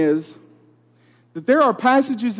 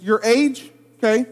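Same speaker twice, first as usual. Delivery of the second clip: ordinary talk, nothing marked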